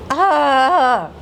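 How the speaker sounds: ordinary delivery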